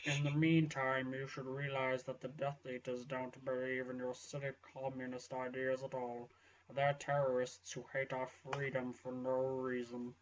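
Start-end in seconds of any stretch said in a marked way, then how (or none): none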